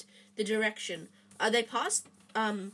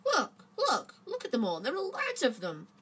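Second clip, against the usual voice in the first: excited urging; 4 semitones higher, 3 dB louder than usual. A narrator's reading. Harry urging Ron excitedly.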